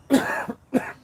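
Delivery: real chesty cough; a harsh cough from the chest. Real, not acted. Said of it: "sorry, I'm coughing".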